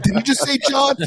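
high incredulous mock gasp; mock ghost hunter. Mocking ghost hunters hearing a name, high incredulous. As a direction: parody the excited ghost hunter. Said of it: "I'm like".

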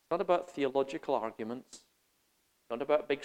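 usual speaking voice

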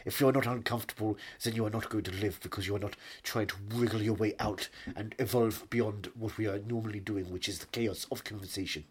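put-on measured German-accented English; German film-director accent. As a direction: philosophize in a thick German accent.

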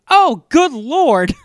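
loud, high, stretched-out imitation; hammed-up cry of dismay; mock shocked exclamation. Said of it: "when he goes".

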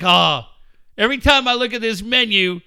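loud put-on whine over the bill; mock stingy pal. Host mocking co-host dodging the tab, loud whine. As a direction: whine to dodge paying. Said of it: "you'll be like".

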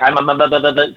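stuck repeating one word; mock stammering. Fifteen-year-old facing a famous wrestler, stammering. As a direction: imitate a tongue-tied kid who can't finish. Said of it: "I'm stammering 'cause I was like".

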